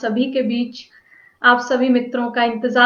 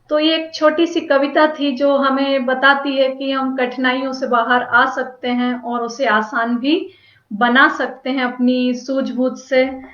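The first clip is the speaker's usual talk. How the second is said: ordinary talk, nothing marked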